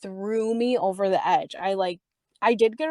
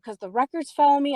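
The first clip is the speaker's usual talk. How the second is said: quick, high retold protest; agitated explanation, pitched up; raised voice; 6 semitones higher, faster than usual. Customer explaining fallen records to staff, raised voice. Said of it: "raising my voice".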